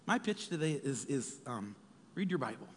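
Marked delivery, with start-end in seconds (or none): none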